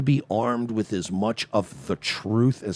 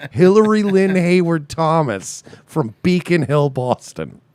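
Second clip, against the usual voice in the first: higher, louder, punching each name; emphatic; 7 semitones higher, 6 dB louder than usual. Shown as a demonstration. Host loudly repeats her full name to make the point. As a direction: hammer the full name home.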